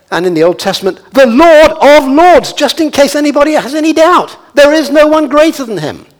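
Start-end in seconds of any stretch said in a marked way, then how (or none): 1.1-6.1 high, loud, driving sermon voice; fervent proclamation, pitched high and loud; impassioned preaching. Real, not acted. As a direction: preach it at full force, building to ringing cries. Preaches at full force.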